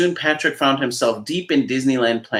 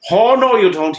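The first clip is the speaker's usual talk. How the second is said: higher, louder panicked refusal; alarmed cry; 10 semitones higher, 7 dB louder than usual. Acted. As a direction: balk in fright at the door. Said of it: "he cried in alarm".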